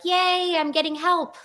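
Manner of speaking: high-pitched, cheery little "yay"; retold excitement. Patient recalling her relief at getting help, retold excitement.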